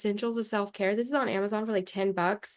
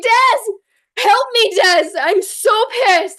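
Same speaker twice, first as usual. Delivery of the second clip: much higher and louder frustrated plea to the coach; upset plea; 12 semitones higher, 11 dB louder, much slower than usual. Retold or imitated. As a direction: whine loudly for help, fed up. Retelling her upset plea to coach.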